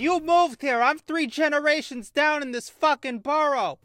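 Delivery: high, loud mock New York pigeon; sassy pigeon. Pigeon telling off a newcomer, loud high sneer. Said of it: "That pigeon said".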